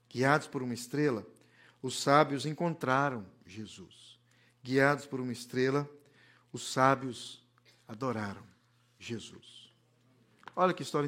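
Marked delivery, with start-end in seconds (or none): 0.1-9.7 fading preached refrain. Acted. Repeated sermon refrain fading.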